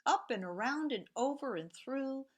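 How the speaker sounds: lively storybook reading with whispered refrains; reading to children. A narrator's reading. Teacher reads Two Little Trains to the class, sing-song storytelling. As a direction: read it like a bedtime picture book, rhythmic and playful.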